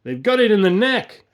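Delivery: high, loud shouted answer; yelled reply. A narrator's reading. Tom answering Bill in the trench, yelled reply. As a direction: shout back over gunfire. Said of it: "Tom yelled back".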